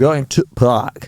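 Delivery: slow imitation of Yorkshire speech. Shown as a demonstration. Showing off a favourite accent, slow Yorkshire. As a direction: lean slowly into Yorkshire vowels. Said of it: "like, a Yorkshire accent".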